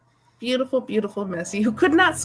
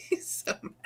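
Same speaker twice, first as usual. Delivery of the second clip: laughing whisper; 6 semitones higher, 11 dB quieter than usual. Real, not acted.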